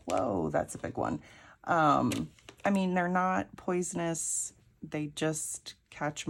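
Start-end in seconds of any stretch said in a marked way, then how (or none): 0.1-1.2 low, deep mock husband voice; husband impression. Husband seeing the big snake, low impressed mock voice. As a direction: drop the voice like her husband. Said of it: "even he was kinda like".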